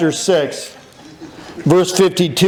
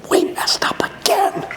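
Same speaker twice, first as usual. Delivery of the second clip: high, slow sheepish whisper; guilty whisper; 14 semitones higher, 4 dB quieter than usual. Acted. Disciples confessing their mistake, slow high whisper. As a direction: whisper it like a caught kid.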